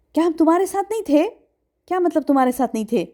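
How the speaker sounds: ordinary talk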